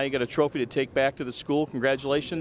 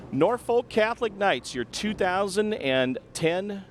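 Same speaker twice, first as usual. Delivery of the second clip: breathless sideline reporter; 6 semitones higher, slower than usual. Real, not acted. Sideline reporter crowns the champions.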